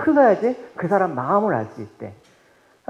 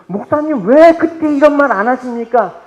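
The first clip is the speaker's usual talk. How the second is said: complaining congregant; 6 semitones higher, 10 dB louder than usual. Acted. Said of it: "저한테".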